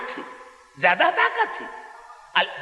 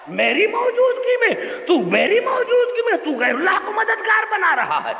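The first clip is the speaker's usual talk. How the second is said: laughing mock rebuke; 10 semitones higher than usual. Mocking God's rebuke, laughing.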